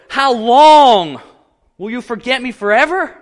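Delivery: desperate plea, voice straining high; anguished cry. Shown as a demonstration. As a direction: cry it out to God in despair. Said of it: "the deep cry of anguish".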